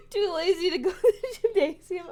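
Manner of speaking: high, loud, voice cracking with laughter; can barely get it out for laughing; laughing hard. Real, not acted. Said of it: "I'm laughing so hard I'm cracking".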